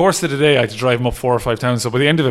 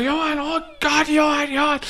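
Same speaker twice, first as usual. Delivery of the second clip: imitated scream, much higher; mock screaming of a name, much higher. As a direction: call out after him, much higher. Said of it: "Just screaming in after him".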